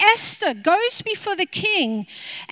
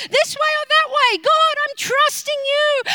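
much higher, fast, near tears; desperate pleading; 9 semitones higher than usual. Retold or imitated. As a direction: plead with God, almost crying. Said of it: "I love to argue again".

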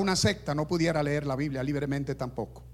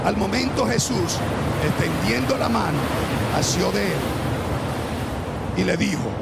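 preaching at full force; 5 semitones higher than usual. Acted. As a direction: preach the storm story to the congregation. Preached narration.

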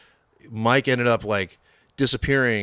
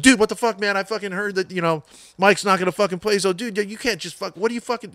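high, worked-up rant in a friend's voice; imitated yelling; 9 semitones higher than usual. Angry best buddy phoning about the show, imitated yelling. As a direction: rattle off an agitated high-pitched tirade. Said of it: "he was yelling".

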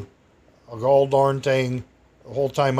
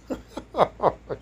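laughs; 7 semitones higher, 4 dB quieter than usual. Real, not acted. Laughing at own story.

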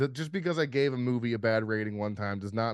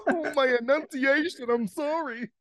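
laughing apology; 14 semitones higher, 4 dB louder than usual. Real, not acted. Laughing at own slip.

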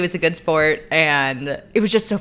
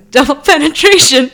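laughing blurt; 7 semitones higher, 9 dB louder than usual. Retold or imitated. Date blurts answer, retold.

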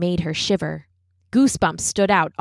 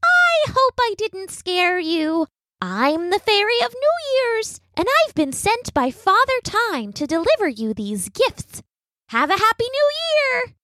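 very high, bright, tiny fairy greeting; a sparkly little fairy saying hello; pleasant little fairy voice; 13 semitones higher than usual. Acted. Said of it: "in a pleasant little voice".

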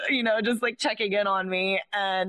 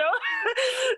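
high laughing burst. Real, not acted. Laughing while retelling fear.

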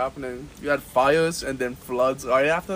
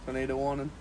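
usual speaking voice

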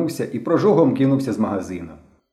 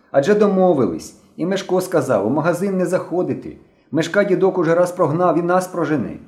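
raised-pitch reproach to a friend; reproachful; 7 semitones higher than usual. A narrator's reading. Slavik warning Henka off the shop, reproachful. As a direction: remind him of the deal, scolding mildly. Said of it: "докоряв йому Славик".

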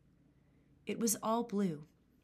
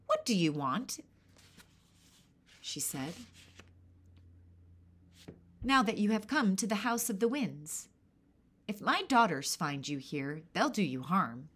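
put-on gruff old hag, a bit louder; 4 dB louder than usual. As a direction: warn like a cranky old woman.

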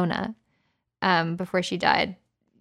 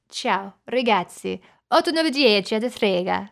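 put-on Italian accent. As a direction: show off a fake Italian. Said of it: "I also do Italian".

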